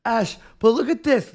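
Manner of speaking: ordinary talk, nothing marked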